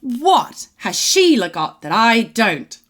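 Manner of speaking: drawn-out affronted demand; mock outrage. Old lady scolds the cheating old man, mock outraged and slow. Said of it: "she's absolutely outraged".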